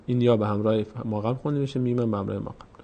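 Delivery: ordinary talk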